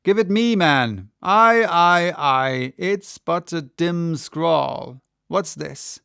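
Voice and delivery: loud then slow, squinting read; gruff captain. A narrator's reading. Ahab grabbing the letter, loud then slowing. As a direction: bark then peer at the scrawl. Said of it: "cried Ahab".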